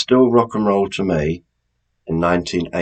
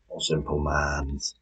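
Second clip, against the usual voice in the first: Minds said as Mans in a Hull accent, slow and soft; 4 semitones lower, 10 dB quieter than usual. Shown as a demonstration. Quizmaster joking about Hull, slow soft Hull accent. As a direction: drawl Simple Mans the Hull way. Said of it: "if you're from Hull".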